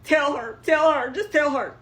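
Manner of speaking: high, loud frantic pleading; high frantic begging. Retold or imitated. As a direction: beg her, high and loud. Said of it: "she's like".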